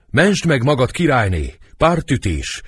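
high, urgent call from outside; loud dramatic warning shout. A narrator's reading. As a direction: cry out the alarm. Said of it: "Még kívül kiált".

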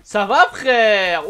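loud, drawn-out shouted greeting; calling out. Real, not acted. Streamer hailing another player in game, calling out. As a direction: holler it, stretching the words.

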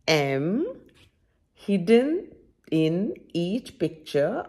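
slow, clear storybook reading; reading to children. Acted. Teacher reading a picture book aloud to kids, slow and clear. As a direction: read each page slowly to young listeners.

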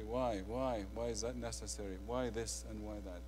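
soft, fast whining questions; mock grumbler. People complaining about the food, quiet mock whining. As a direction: mutter the complaints like a petty grumbler. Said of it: "They say".

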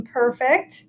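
ordinary talk, nothing marked

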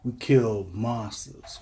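ordinary delivery

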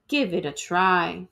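usual speaking voice

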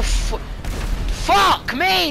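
high, drawn-out angry cry; frustrated rage. Real, not acted. Gamer damning the game, frustrated high yell. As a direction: stretch the curse out in anger.

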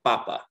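PApa, second-to-last syllable stressed; stress on first syllable. Shown as a demonstration. Teacher shows natural Spanish emphasis, papa stressed on first syllable. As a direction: lean on the start of papa and let it fall. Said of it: "emphasized on the second to last".

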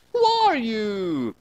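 high, slow startled cry; the desk lady cries out in alarm, drawn out; mock scream. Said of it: "she screamed".

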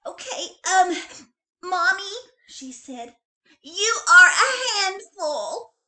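put-on high, loud giggling little kid; little bunny sweetly complimenting mom; giggly child. A narrator's reading. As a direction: blurt it out proudly, laughing. Child says something nice.

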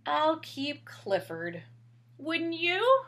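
lightly animated storybook narration; reading to children. A narrator's reading. Reading Clifford aloud to a child, gentle storybook voice. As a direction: read it out gently to little ones. Reads picture book aloud.

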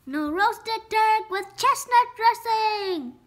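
high, loud, slow proclamation; crying out. A narrator's reading. Runt relieved Turk won't be roasted, slow loud cry. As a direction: draw the cry out loudly. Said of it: "cried Runt".

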